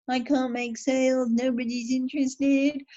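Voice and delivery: a light mock Australian accent on a moaning consultant; lightly voiced Aussie complaint. Consultant in Australia moaning about no sales, mock Aussie accent. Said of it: "probably not in that accent".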